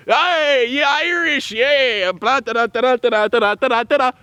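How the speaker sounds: high, loud mock drunk reveller; rowdy drunk partier. Mocking drunk Irish partiers running through the city, high and rowdy. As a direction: ham up a rowdy Saint Patrick's Day drunk.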